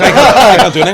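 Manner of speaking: high, loud mock protest, half laughing; mock helplessness. Comic facing a friendly heckler, mock helpless protest.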